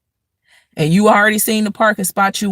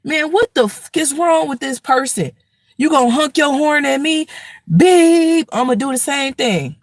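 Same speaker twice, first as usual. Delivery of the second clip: irritated driver; 7 semitones higher than usual. Retold or imitated. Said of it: "Now you like".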